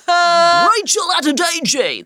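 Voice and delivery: slow, drawn-out host announcement; X Factor announcer. Retold or imitated. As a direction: stretch the name like a TV host. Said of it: "the guy that used to introduce people on X Factor".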